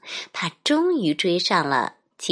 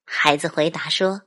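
ordinary delivery

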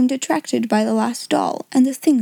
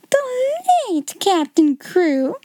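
higher, slow, stunned repetition; shocked cry; 10 semitones higher, slower than usual. Acted. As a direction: echo the words in horror. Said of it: "she cried out".

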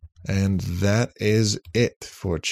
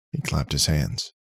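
ordinary delivery

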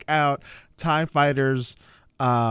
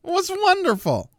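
excited; 11 semitones higher than usual. Retold or imitated. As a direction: gush with excitement. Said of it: "I was like".

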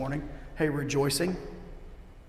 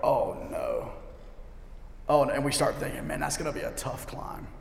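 soft, sinking groan of resignation; dejected dread; 2 semitones lower, 8 dB quieter than usual. Acted. Stressed climber seeing a mountain ahead, dejected and quiet.

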